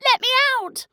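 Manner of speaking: high, loud yell in a put-on girl's voice; bellowing. A narrator's reading. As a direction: shout to be let out. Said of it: "She bellowed".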